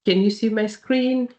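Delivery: ordinary talk, nothing marked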